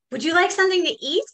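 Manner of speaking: high, fast little ant voice; eager tiny ant offering food. Acted. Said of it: "asked the ant".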